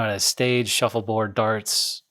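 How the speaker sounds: ordinary talk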